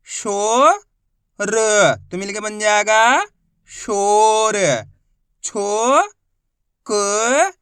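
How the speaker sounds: loud, drawn-out syllables, each sounded separately; a teacher chanting sounds for kids to repeat; sounding out Hindi syllables for a class; slow phonics drill. Shown as a demonstration.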